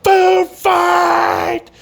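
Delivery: high excited yell; shouting. Retold or imitated. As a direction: yell it out like starting a brawl. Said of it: "and he's like".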